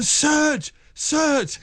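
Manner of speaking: high, drawn-out awed call; mock awed cry of a friend; calling out in awe. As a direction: call his mate's name in wonder. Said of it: "he was going".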